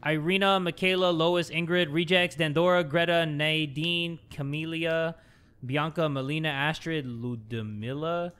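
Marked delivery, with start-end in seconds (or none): none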